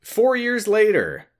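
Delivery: high, slow, drawn-out caption voice; mock title card. Host reading a flash-forward caption aloud, mock title card. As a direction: announce the time skip in a stretched high voice.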